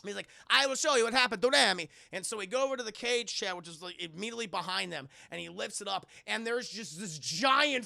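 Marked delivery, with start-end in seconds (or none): 0.5-1.9 loud, proud mock dictator; hammed-up Mussolini. Mussolini showing off his rat to guests, mock proud. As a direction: announce it like a showman villain. Mussolini voiced in retold scene.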